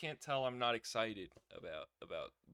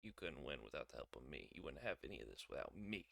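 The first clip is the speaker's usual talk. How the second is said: quoting Trick; 11 semitones lower, 12 dB quieter, faster than usual. Retold or imitated. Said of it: "Trick said".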